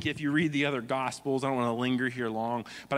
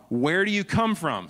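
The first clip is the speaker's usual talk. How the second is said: higher, louder urgent question; Pilate demanding; 6 semitones higher, 6 dB louder than usual. Retold or imitated. Pilate questioning Jesus inside, raised urgent demand. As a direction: press him for an answer.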